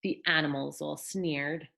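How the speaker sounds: put-on gentle read-aloud for children; storybook narration for little ones; bedtime story reading. A narrator's reading. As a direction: tell it like a picture book at bedtime.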